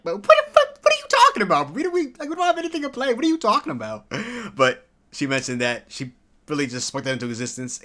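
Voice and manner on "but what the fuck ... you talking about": high, loud mock panic from the agent; agent freaking out. Vlogger imitating the agent's panic, high loud squeal. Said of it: "Even my agent's like".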